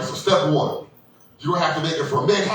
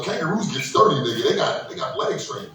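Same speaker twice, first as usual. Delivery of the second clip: normal talking voice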